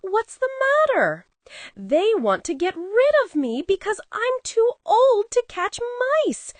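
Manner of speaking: lightly voiced cranky cat; grumpy cat voice. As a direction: whine like an old grumpy cat.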